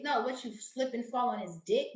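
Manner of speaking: usual speaking voice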